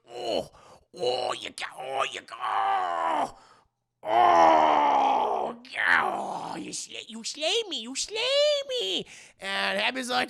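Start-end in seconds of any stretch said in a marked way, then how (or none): 0.1-9.1 wailing high mock death cries, loud; theatrical dying knight game; hammed-up death. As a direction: overact being slain. Bear hammily dying in play.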